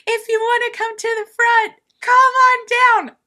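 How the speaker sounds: high, loud imitated stage announcement; calling out to a crowd. Imitating band calling crowd forward.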